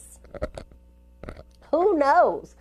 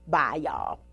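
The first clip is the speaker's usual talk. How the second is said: much lower, softer farewell; low sign-off; 10 semitones lower, 6 dB quieter than usual. Shown as a demonstration. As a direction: drop the voice down low for the goodbye. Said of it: "We're gonna come back and I'll say".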